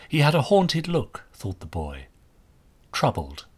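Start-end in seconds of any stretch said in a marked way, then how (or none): none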